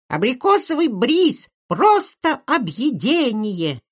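put-on high, slow squeaky kid's jingle voice; breathy cutesy giggly. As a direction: sing it out like a cute freckled ad kid. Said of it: "ребёнок с веснушками и писклявым голосом". (писклявый детский голосок из рекламы)

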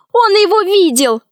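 put-on high, urgent girl's cry; high-pitched excited cry; shouted. A narrator's reading. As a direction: call out the accusation in a high voice. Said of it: "закричала Алиса".

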